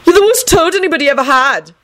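high-pitched angry insult; heated. A narrator's reading. Neville insulting his toad, heated. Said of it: "he added heatedly".

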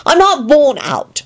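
high, slow, tearful protest; acted sniffly denial; Elizabeth, sniffy. A narrator's reading. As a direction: protest it sulkily, drawn out. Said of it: "she sniffed".

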